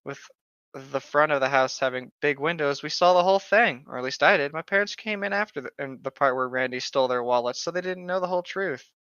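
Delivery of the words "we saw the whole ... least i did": usual speaking voice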